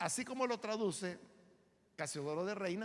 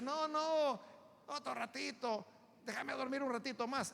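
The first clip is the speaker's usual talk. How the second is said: high, soft whine for more sleep; lightly voiced child begging in bed; pleading sleepy child; 4 semitones higher, 4 dB quieter than usual. Child to parent asking for more sleep, soft pleading.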